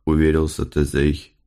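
ordinary delivery

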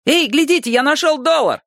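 put-on very high, excited kid; child calling out. A narrator's reading. Child shouting about a found dollar, excited high voice. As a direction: pipe up like a thrilled child. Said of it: "крикнул один".

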